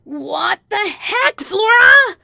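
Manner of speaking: very high, drawn-out shriek; enraged mistress. Acted. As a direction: screech in outrage down the phone. Mistress calls in a rage.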